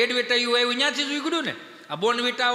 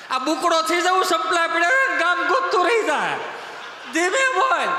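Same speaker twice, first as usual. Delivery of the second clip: loud, higher, laughing hushed warning; mock warning; 5 semitones higher, 4 dB louder than usual. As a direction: warn him loudly while laughing. Warning the tourist about lions, laughing. (સિંહથી ચેતવતો હસતો મોટેથી અવાજ)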